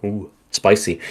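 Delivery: plain reading voice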